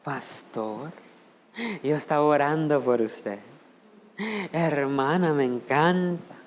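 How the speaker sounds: lightly voiced sweet-talking churchgoer; soft fake-holy praise; mock pious flattery. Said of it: "Pero aquí".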